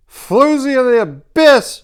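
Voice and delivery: put-on very high, very loud, slow drunken yell. A narrator's reading. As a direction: hurl the insult across the tent, drunk. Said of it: "a drunken Thuvia yelled".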